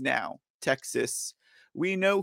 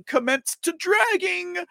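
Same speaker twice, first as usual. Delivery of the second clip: hyped-up call; 13 semitones higher than usual. Acted. Rallying the audience to drag.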